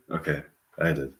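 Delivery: ordinary talk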